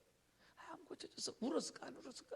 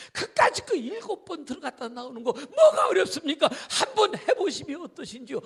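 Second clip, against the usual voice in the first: urging; 4 semitones higher, 9 dB louder than usual. Acted. Servant urging Naaman.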